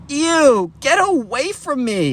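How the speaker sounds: high-pitched mock squeal of disgust; disgusted recoil. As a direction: shoo someone away in disgust. Ava pushes smelly Ben away.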